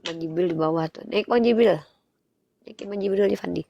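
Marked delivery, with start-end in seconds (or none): none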